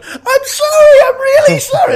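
very high, loud panicked shriek; frightened girl shrieking sorry while hiding from a T-Rex; shrieking apology. Retold or imitated. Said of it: "she starts shrieking about how sorry she is".

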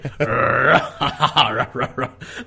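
imitated laugh that rolls into dog-like barks; growl, then bark out the laughter; growly barking laugh. Imitating the actor in the helicopter, growly barky laugh. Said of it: "growly, barky laugh".

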